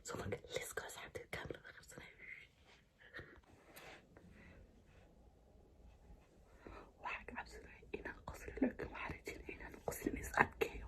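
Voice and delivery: secret whisper, fast and hushed. Real, not acted. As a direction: whisper the plan so nobody hears. Hiding before pranking her husband.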